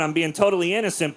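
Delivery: normal talking voice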